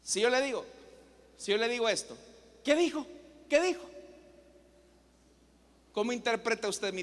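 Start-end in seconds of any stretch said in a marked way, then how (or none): 2.6-3.8 high, sharp challenge, picking a fight; raised pitch, confrontational questioning. Shown as a demonstration. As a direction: demand it as if spoiling for a fight.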